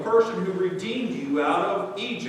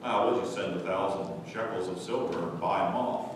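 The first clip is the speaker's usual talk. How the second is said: lower, offhand mocking of Israel; mock dismissive excuse; 11 semitones lower, 6 dB quieter than usual. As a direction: shrug it off like a careless fool.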